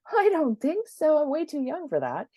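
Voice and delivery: high, loud indignant no; mock defiant refusal. Telling the doctor she is too young, high mock defiance. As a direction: brush off the doctor with a hammed-up huff. Retold own defiant reply.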